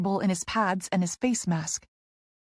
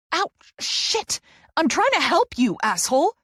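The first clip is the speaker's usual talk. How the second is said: higher, louder yelp into a yell; pained yell; 9 semitones higher, 5 dB louder than usual. A narrator's reading. Therapist knocked into the rack, yelling at him. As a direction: cry out in pain then rage.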